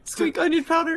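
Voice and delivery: high squeaky rat voice. Acted.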